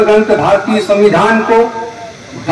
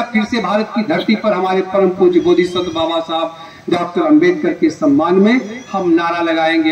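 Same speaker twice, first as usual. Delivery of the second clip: ordinary talk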